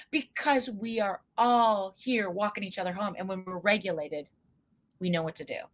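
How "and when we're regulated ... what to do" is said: ordinary delivery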